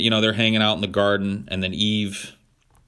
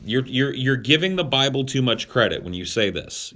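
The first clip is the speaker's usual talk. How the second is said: normal talking voice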